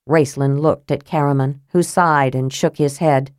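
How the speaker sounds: plain reading voice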